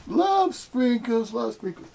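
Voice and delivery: high and very slow, drawn-out sugary mockery; mocking Muji. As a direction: stretch it out to ridicule the phrase. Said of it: "like Muji says".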